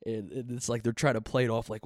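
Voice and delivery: usual speaking voice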